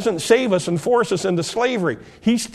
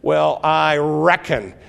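put-on slow, drawn-out Southern accent; Southern drawl; 3 semitones lower, slower than usual. Preacher imitating Southern folks saying reckon, Southern accent. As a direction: lean into a lazy Southern I reckon. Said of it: "Southern people say".

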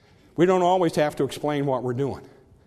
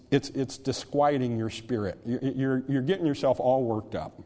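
ordinary talk, nothing marked

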